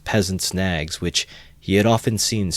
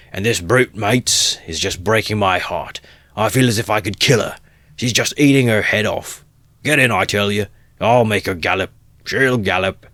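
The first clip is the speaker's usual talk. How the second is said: loud, angry rant; drunken rage; 3 semitones higher, 5 dB louder than usual. Acted. Mikulka raging about his old mare, angry shouting. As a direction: spit out his fury at the mare.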